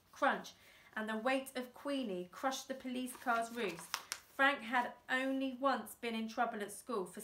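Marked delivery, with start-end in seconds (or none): none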